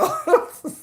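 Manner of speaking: high giggle; short high real laugh. Real, not acted. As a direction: giggle at the joke.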